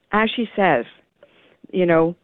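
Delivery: ordinary delivery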